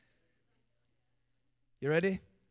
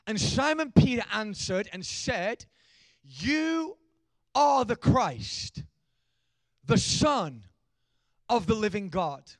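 slow, loud, high preached reading; preaching scripture; 5 semitones higher, 9 dB louder, much slower than usual. Acted. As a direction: proclaim each word slowly.

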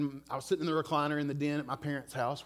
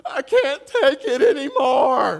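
blubbering; 11 semitones higher, 15 dB louder, slower than usual. Retold or imitated. A son breaking down to his worried mom, sobbing out loud. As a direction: voice cracking high and loud, slow sobbing. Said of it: "I just started blubbering".

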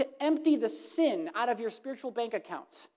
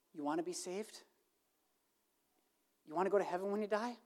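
much softer, lower and urgent; soft tender plea; 4 semitones lower, 9 dB quieter, faster than usual. Acted. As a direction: plead gently, like Jesus calling someone home. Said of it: "lovingly and softly and tenderly and urgently saying".

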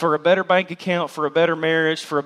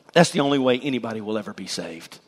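ordinary talk, nothing marked

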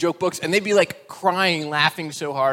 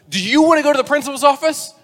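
loud, high mock-angry threat; outraged teacher; 10 semitones higher, 10 dB louder, faster than usual. Teacher threatens the cheeky little boy, outraged. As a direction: scold in hammed-up outrage.

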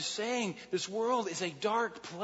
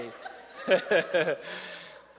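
short amused exhale laugh; breathy chuckle. Real, not acted. Laughing at own joke.

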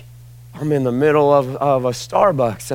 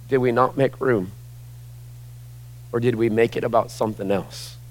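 usual speaking voice

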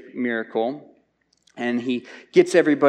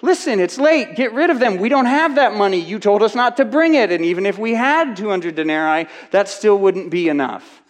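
much higher, louder frantic complaint; frustrated disciples; 7 semitones higher, 5 dB louder than usual. Retold or imitated. Preacher voicing disciples pleading with Jesus, high and loud. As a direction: pile the excuses on, fed up. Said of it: "the disciples' response here is".

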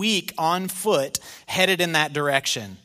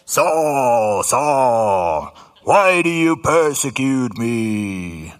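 louder, slow, commanding voice from heaven; booming voice of Jesus; 5 semitones lower, 6 dB louder, much slower than usual. Acted. Preacher voicing Jesus calling out to Saul. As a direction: call down from the light with weight. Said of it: "don't you think it'd be more like".

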